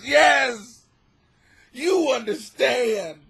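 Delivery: ordinary talk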